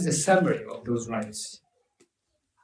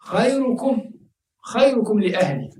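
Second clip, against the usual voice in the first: high, ringing quoted hadith; raised Arabic hadith quote; 6 semitones higher, much faster than usual. Acted. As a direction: lift the voice to quote the Prophet. Quoting the Prophet in Arabic.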